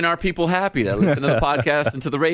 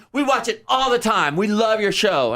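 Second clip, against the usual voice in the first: high excited fan voice; imitating gushing fan; 9 semitones higher than usual. Bride raving about the show, high gushing. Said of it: "She goes".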